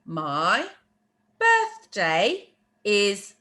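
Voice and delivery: slow, separate words with pauses; deliberate teacher pacing, gaps between words. Shown as a demonstration. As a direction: spell out the phrase one word at a time. Teacher translating word by word.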